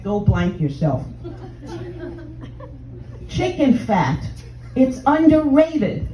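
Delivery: ordinary delivery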